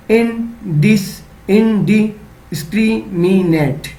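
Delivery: Indiscriminate drawn out slowly in chunks; slow sounding out. Shown as a demonstration. Teacher showing children how to chunk a word, slowly. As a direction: spell indiscriminate out slowly, syllable by syllable. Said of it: "एक साथ पढ़ना है".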